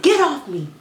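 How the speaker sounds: mock drunk snap. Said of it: "I said".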